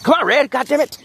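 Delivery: high frustrated call to the dog; shouting across a field. Real, not acted. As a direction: yell for Red, annoyed. Calling dog again.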